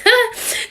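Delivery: high burst of laughter; a quick loud laugh; bright laugh. Real, not acted. Laughs after whisper bit.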